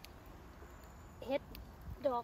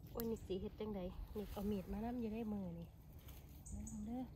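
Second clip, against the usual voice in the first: hushed; 3 semitones lower, 18 dB quieter than usual. Real, not acted. Close-up of a mushroom with a knife.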